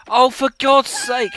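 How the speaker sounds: loud, slow exasperated outburst; fed up with the game, let it out. Real, not acted. Game goes wrong on stream.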